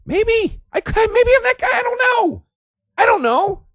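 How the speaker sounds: a high comic whimper of self-doubt; squeaky self-doubt. Acted. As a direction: shrink into a tiny doubtful squeak.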